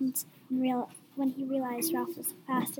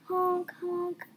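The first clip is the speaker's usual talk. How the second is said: high, honking horn noise; imitated car horn; 5 semitones higher than usual. A narrator's reading. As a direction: honk like a car.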